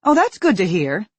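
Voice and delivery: loud, biting sarcasm; mock relief you don't feel; sarcastic. Angry mother mocks her son's excuse, loudly sarcastic. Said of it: "in her most sarcastic voice".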